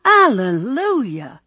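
high, slow, ringing cry; jubilant shout. A narrator's reading. Bishop celebrating the wedding news, shouting joyfully. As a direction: belt it out, stretching the word. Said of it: "shouted his bishop".

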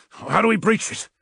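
clipped, curt question; terse. A narrator's reading. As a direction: ask it short and hard. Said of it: "asked the legionary tersely".

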